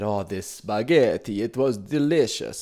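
a light mock Italian lilt; Italian accent. Comedian reminiscing to his aunt, in a lightly hammed-up Italian accent. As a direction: savour the dish like a hammed-up Italian. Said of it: "putting on an Italian accent for spaghetti".

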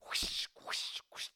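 unvoiced rushing whoosh. A narrator's reading. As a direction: breathe out the sound of running steps. Footsteps through mud.

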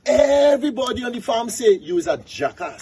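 high-pitched pig tirade, piling up the accusations; berating pig. Acted. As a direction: rail at the donkey in a high pig voice. Pig berates donkey in tale.

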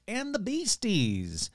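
normal talking voice

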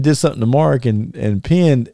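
ordinary delivery

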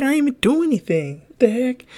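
high-pitched, incredulous complaint; indignant protest. Retold or imitated. Retold protest at the referee.

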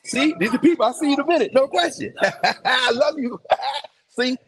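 high, loud, laughing welcome to arrivals; loud joyful hollering at newcomers; excited laughing greeting. Real, not acted. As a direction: greet friends coming in with big laughs. Greeting people arriving, laughing loudly.

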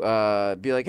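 ordinary delivery

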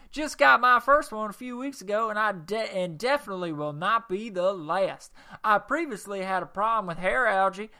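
loud, hammed-up Texas accent, jumping high at the end. Reading Ted from Houston's review in an exaggerated Texas accent. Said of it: "do my Texas accent a little bit more".

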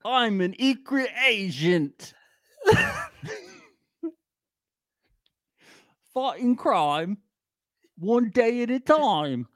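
slow, high put-on British voice, laughing; hammy secret agent voice; mock British spy. Playing a British secret agent for laughs, slow, high mock British accent.

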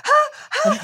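short high-pitched mock shrieks, half laughing; squealed mock gasps of panic; imitated panicked scream. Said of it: "and she, like, screams".

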